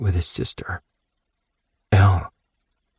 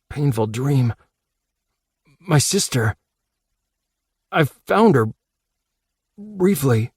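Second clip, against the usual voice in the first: put-on boy's earnest confession, a bit higher; Max sincere; 5 semitones higher than usual. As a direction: open up to her gently and honestly. Max confides in Casey.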